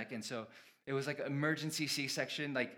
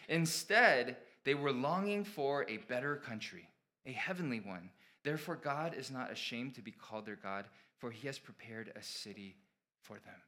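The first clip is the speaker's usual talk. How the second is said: scripture reading; 6 dB louder than usual. Acted. Pastor reads Hebrews to his congregation, raised proclaiming voice. Reads verse sixteen aloud.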